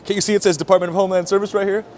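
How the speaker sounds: loud, high, rushed imitation of an irate officer; fast mock outburst; retold scolding. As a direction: act out an exasperated telling-off. Officer's side of retold quarrel.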